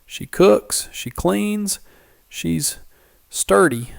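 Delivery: high, halting sales plea; voice rising in pitch. A narrator's reading. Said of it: "his voice rising in pitch".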